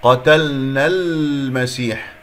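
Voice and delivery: قتلنا المسيح slow, n running straight into the l; joined recitation. Shown as a demonstration. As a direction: recite قتلنا المسيح linked as nal-masih. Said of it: "نل من النون المفتوحة".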